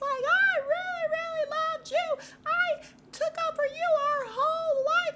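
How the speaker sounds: shrieky imitated yelling, very high; drawn-out mock shriek, extra loud and up there; Jacqueline. Jacqueline yelling at the friend she defended, shrieky. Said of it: "something shrieky about her voice as she's yelling".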